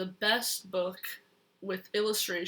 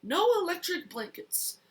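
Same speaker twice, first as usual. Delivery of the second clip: loud; 7 semitones higher, 3 dB louder than usual. Acted. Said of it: "said Farmer Brown".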